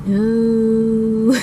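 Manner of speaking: long, drawn-out dismayed "no"; dreading no. Retold or imitated. Teen hearing dad find the test, dreading. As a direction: stretch the word out in mock dread. Retold dread on being caught.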